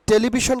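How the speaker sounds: "টেলিভিশন" said te-li-bi-shon, plain t and b; the wrong "টেলিভিশন", unpuffed t, "sh" in the end; common mispronunciation. Shown as a demonstration. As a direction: say "টেলিভিশন" quickly joined as telibishon. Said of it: "কিন্তু হবে না".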